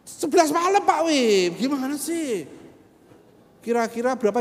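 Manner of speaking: high, loud pressing haggler; pushy becak driver. Retold or imitated. Driver pressing the stingy preacher late at night, loud and high. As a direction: lean on him like an impatient driver.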